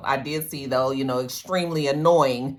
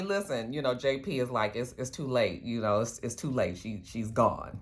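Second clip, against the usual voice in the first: softer, hushed it's too late; gentle bad news; 6 semitones lower, 8 dB quieter than usual. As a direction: break it to him quietly. Telling Tommy she's dead.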